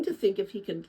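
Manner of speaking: usual speaking voice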